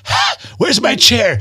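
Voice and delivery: high, drawn-out plea; hammed-up desperate cry for relief; mock desperation. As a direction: wail it in exaggerated distress. Acting out desperation after one lap.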